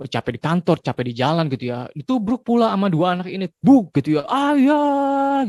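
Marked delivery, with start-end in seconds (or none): 3.5-3.9 bump sound. Retold or imitated. Kids crash into tired father. 4.3-5.5 put-on high bright child's call of ayah. A child runs at her tired father, calling ayah.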